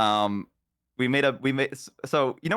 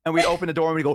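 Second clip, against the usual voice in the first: usual speaking voice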